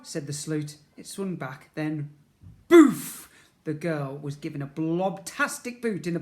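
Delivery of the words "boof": very loud kick sound; loud boof. Acted. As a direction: punch out the kick noise.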